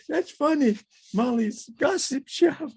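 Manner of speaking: high amused laugh over the words. Acted. Man amused by Molly's joke, high laughing. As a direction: chuckle at the joke.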